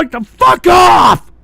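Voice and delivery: loud, slow, stretched shout; angry dismissal. Real, not acted. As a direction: yell them away, drawing it out. Snaps at a viewer.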